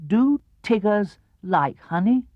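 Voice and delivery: ordinary delivery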